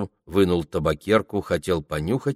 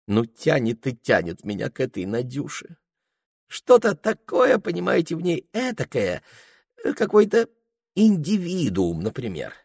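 fawning peasant voice; 7 semitones higher than usual. Acted. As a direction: play it as a wheedling coachman. Ilya gushes about Nadya.

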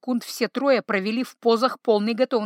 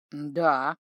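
unsure; 7 semitones lower than usual. A narrator's reading. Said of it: "немного неуверенно ответил тролль".

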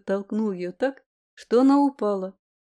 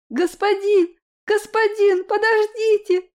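put-on high, loud child's call; little girl calling out; 11 semitones higher, 5 dB louder than usual. A narrator's reading. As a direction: call after him like a small girl. Girl calls after the master.

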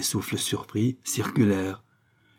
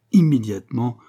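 usual speaking voice

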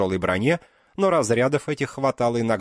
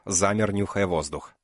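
ordinary delivery, nothing marked

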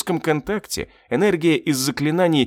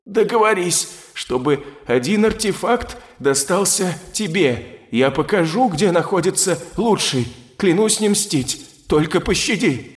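higher, otherworldly begging voice; pleading demon; 5 semitones higher than usual. A narrator's reading. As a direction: beg slowly like a beaten demon. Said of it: "еле слышно прошипел демон".

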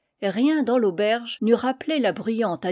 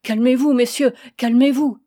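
pleading; 3 semitones higher, 7 dB louder than usual. A narrator's reading. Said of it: "la vieille supplia".

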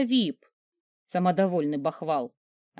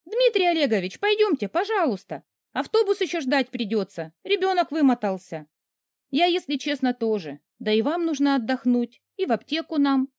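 уговаривая; 7 semitones higher than usual. Acted. Героиня уговаривает начальника.

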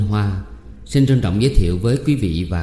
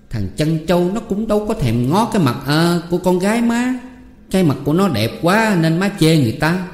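put-on cheeky young son Sáu; 7 semitones higher than usual. A narrator's reading. Son Sáu teasing his mother over bananas, cheeky and higher. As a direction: toss off proverbs like a cocky son.

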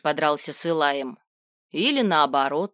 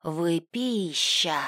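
hissing demon voice; much slower than usual. Acted. Captured demon threatens them.

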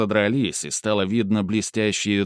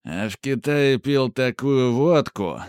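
put-on drunk voice; 4 semitones higher than usual. A narrator's reading. Drunk deputy rambling.

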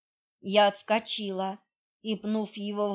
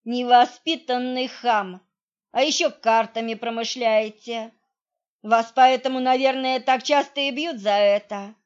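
scolding; 2 semitones higher, 7 dB louder than usual. Acted. Heroine scolds rude thug.

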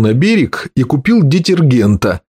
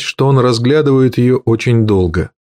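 usual speaking voice

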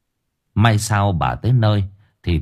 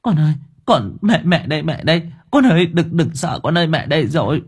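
higher, pleading, on the edge of tears; a mother soothing her frightened child through tears; mother comforting her feverish son, tearful; 7 semitones higher than usual. A narrator's reading. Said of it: "rưng rưng nước mắt".

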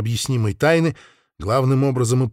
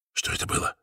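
breathless confused whisper; 10 dB quieter than usual. Acted. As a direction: whisper it, bewildered. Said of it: "растерянно спросил он".

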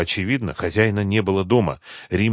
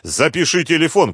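raised-pitch narrated shout; mild shout, pitched up; calling out; 7 semitones higher, 4 dB louder than usual. A narrator's reading. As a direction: call out an urgent instruction. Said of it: "крикнул Вадим".

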